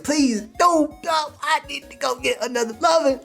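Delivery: high, hammed-up begging; mock pleading.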